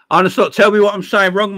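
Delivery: called out high and loud; loud challenge. Real, not acted. Streamer calling out a chatter, loud and high. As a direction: challenge him across the chat.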